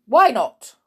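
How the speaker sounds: high, loud challenge; sharp demand. A narrator's reading. Matron interrogates the new boy, sharp. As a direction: demand a reason as a stern matron.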